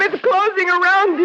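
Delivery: normal talking voice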